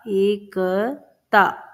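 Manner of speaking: stretched syllable by syllable; Ekta drawn out slowly, each sound apart; slow dictation. Shown as a demonstration. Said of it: "अगला शब्द है एकता".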